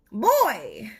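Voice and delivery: plain reading voice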